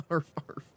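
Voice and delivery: loud playful barks like a dog; dog barking. Retold or imitated. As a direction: bark it out like a dog. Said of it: "I barked like crazy".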